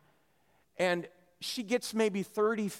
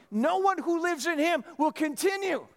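high, insistent raised voice; emphatic quote; 11 semitones higher, 4 dB louder than usual. Acted. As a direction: proclaim the verse as if to the stubborn. Preacher re-quotes the verse emphatically.

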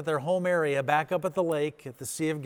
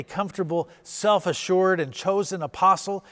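mock pride; 4 semitones higher, 7 dB louder than usual. Preacher mocks Peter's old pride.

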